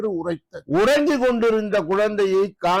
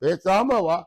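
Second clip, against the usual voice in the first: plain reading voice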